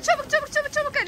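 high, rushed urging; urgent hurrying. Real, not acted. Rushing kid with smoking snack. (acele ettiren heyecanlı ses)

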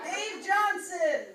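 "Dave Johnson" called out slow and loud, laughing; laughing big slow call of "Dave Johnson"; announcing a name. Acted. Announcing the next roast target.

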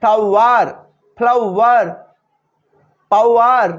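isolated words with full "ow-war" in "ফ্লাওয়ার"; teacher modelling the ow-wa glide in "ফ্লাওয়ার"; pronunciation drill. Shown as a demonstration. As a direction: draw out both halves of "পাওয়ার". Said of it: "শব্দে প্রয়োগ দেখো".

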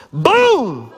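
loud, high burst; booming exclamation. Acted. Preacher flipping on the lights as Tamar, explosive loud boom. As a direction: punch it out like a sudden reveal. Sound effect as lights flip on.

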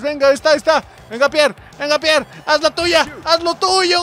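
high, excited urging, climbing as it goes; urgent cheering on. Real, not acted. Commentator urging Pierre toward goal, excited rising calls. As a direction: push the player forward with rising excitement. Urging player on during attack.

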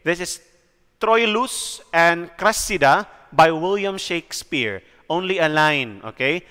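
ordinary delivery

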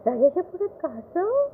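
shocked cry. A narrator's reading. Wife crying out on hearing of the turtle, lightly voiced shock. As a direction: gasp it out in shock. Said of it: "Vijaya ओरडली".

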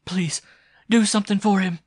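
high, loud, desperate plea; begging. Acted. Girl pleading for her fallen lover, begging. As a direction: implore with the voice pitched right up. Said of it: "she begged".

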